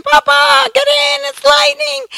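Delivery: high, frantic call to come inside; screaming kids. Retold or imitated. Grandkids calling Papa in from the storm, high frantic shout. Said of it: "they start screaming".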